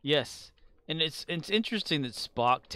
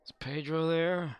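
higher, goofy Napoleon Dynamite voice; 6 semitones higher, slower than usual. Retold or imitated. Streamer still mimicking Napoleon Dynamite, higher and goofy. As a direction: toss it off like a deadpan teen, pitched up. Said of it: "It's like Napoleon Dynamite".

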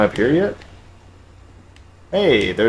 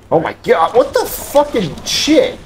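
startled shriek; 12 semitones higher than usual. Real, not acted. Startled by a sudden enemy.

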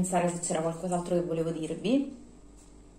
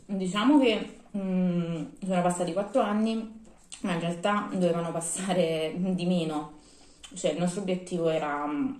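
chewing between words; talking around food; mouth partly full. Real, not acted. Seen 1: bites toast at -3, lips and cheeks working while speaking.

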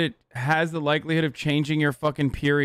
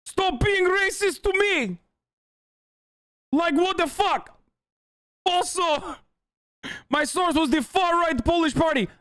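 mocking whiny voice; 11 semitones higher than usual. Streamer mocking the banned chatter's words in a high, whiny voice.

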